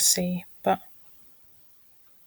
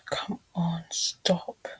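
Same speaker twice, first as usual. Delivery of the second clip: much softer whispered begging; real exasperated whisper; hushed plea; 8 dB quieter, much slower than usual. Real, not acted. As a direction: whisper at the screen to stop. Begging the site to stop.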